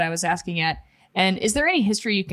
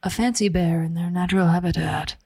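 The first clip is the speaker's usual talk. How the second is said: nature documentary. Pretend. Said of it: "a National Geographic episode".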